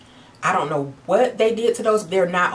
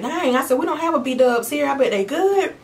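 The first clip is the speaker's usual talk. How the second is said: high, eager and impressed; excited; 8 semitones higher than usual. Retold or imitated. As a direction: sound amazed and hungry. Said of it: "And I'm like".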